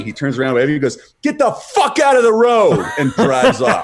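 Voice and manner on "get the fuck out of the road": loud, high cabbie shout; retold angry yell. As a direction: yell it from a cab window, imitating the driver. Retold cabbie yelling at pedestrian.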